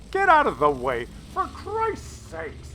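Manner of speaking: fed up with his own artillery, cry it out; exasperated yell. Real, not acted. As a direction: pitch jumps way up and slows on the end. Yelling at the artillery in game.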